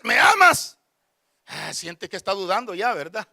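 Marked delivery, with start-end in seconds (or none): 0.0-0.8 raised, pressing question; imitated insistent wife. Wife asking a third time, imitated insistent question. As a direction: act a wife demanding an answer. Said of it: "cuando le diga la tercera vez".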